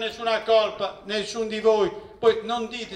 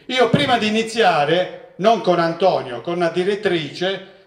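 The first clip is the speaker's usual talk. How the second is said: ordinary delivery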